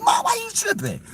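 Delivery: very high, whining pitch; indignant squeak at mom; whiny high complaint. Retold or imitated. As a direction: whine it like a sulky kid.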